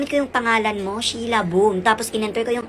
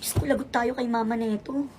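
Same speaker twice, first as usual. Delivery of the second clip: ordinary delivery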